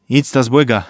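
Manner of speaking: usual speaking voice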